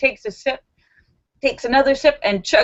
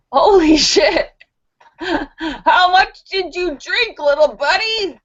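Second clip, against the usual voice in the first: high, drawn-out mock astonishment; amazed monkey; 7 semitones higher, 4 dB louder, slower than usual. Monkey sees the crocodile up the tree, amazed. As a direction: exclaim in hammed-up shock, stretching the words. Monkey's shocked reaction in joke.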